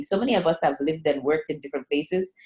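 ordinary delivery

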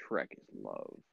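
deep, soft mock voice quoting; low quoted catchphrase; quoted video line. Quoting the video in a deep mock voice.

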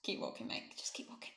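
hushed whisper through the teeth; whispered aside. Retold or imitated. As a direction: whisper it so the cop can't hear. Muttering aside to friend.

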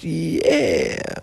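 ordinary talk, nothing marked

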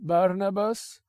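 high, drawn-out name with a rise; mocking master. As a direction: toy with his name. [name] repeats the name.

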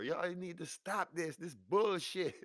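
high, slow, drawn-out holler; exasperated high-pitched scolding; fed-up mock yell. Acted yelling at the fighters.

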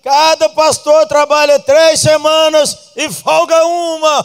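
loud, high, slow proclamation; loud, slow proclaiming of the rule; proclaimed announcement. Retold or imitated. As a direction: shout the elders' decision to the church. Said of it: "falaram assim".

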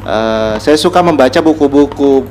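ordinary talk, nothing marked

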